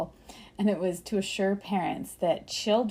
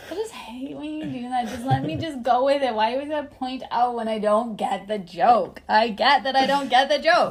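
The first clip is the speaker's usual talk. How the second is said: higher, increasingly loud complaint; real exasperation; 3 semitones higher, 5 dB louder than usual. Real, not acted. Co-host objecting to being corrected on a joke, exasperated. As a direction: gripe at your co-host, getting more worked up.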